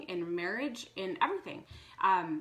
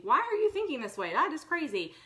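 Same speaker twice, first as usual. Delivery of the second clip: incredulous; 7 semitones higher, 4 dB louder than usual. Retold or imitated. Judging others from the outside.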